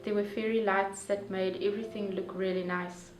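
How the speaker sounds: usual speaking voice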